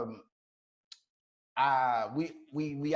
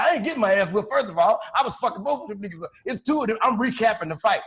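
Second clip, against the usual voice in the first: higher, louder defiant argument; mock heated protest; 6 semitones higher, 4 dB louder, faster than usual. Arguing with the police who taunt him after the fight, defiant. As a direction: argue back at the cops, indignant.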